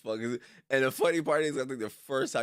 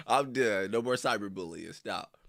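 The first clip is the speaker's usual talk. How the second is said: ordinary talk, nothing marked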